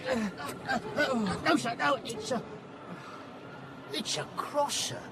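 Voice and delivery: flustered protest. Acted. Man explaining his parcel to police, flustered and halting. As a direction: stammer out an anxious explanation.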